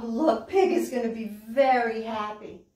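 usual speaking voice

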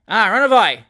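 loud Cockney scoff; mocking cry. Sam Weller mocking the mob, loud. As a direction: scoff at the crowd.

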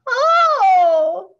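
sudden high loud cry of dismay; shocked child's yell. A narrator's reading. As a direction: yelp it out as the apple flies. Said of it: "yells Schubert".

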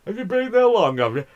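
high, loud mock Yorkshire lady; mocking Barbara. Mocking the Yorkshire seatmate Barbara, high and loud. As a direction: squeak it out like a prim Yorkshire woman. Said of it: "woman from Yorkshire called Barbara and say".

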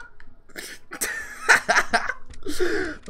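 loud, high, breathy laughter; shouting laughter. Real, not acted. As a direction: laugh out in giddy relief. After winning the fight.

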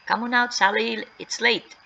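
yelled out. Acted. Two boys to hidden Sally, calling out. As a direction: call out into the dark, moderately loud. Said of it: "both boys yelled out".

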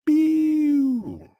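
cartoon pew sound, very high; high zap noise. Fox dismissing Tails with a high cartoon pew. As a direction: squeak a laser zap high up.

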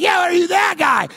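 high, loud, insistent yell; hammed-up fan demanding an answer; shouting stranger.

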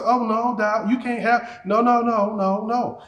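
firm refusal to doubt. Acted. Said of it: "when doubt shows up, I say".

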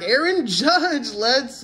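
drawn-out high call of the name; slow, high excited naming. Real, not acted. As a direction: savour the name, thrilled. Excited pull of a star card.

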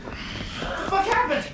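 high, breathless alarm; shocked. Acted. Man seeing his injured friend, high shocked alarm. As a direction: gasp it out in shock. Finding friend badly hurt.

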